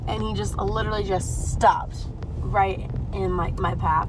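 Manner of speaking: usual speaking voice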